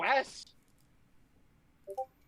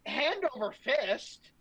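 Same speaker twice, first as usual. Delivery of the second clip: ordinary talk, nothing marked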